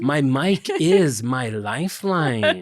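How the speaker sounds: slow, grand spoken word; hammed-up pretentious poet line; beat poet. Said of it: "You're talking like Christopher Watkins".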